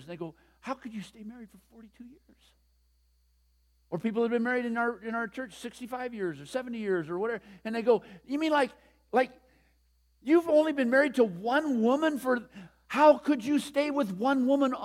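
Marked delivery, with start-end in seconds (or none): 0.6-2.2 whispered in disbelief, a little fast; incredulous whisper. Retold or imitated. Whispering the shocked question about a forty-two-year marriage. 8.2-14.7 incredulous. Retold or imitated. People asking in disbelief.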